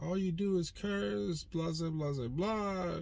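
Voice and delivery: high mocking whine; lightly mocked nagging voice; mock stepdaughter. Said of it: "she's like".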